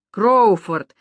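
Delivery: put-on loud drunken bellow of a name; yelling. A narrator's reading. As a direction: bellow it out across the beach. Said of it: "заорал большой Боб Бейли". (громкий пьяный рёв)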